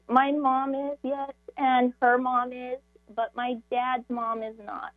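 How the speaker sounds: normal talking voice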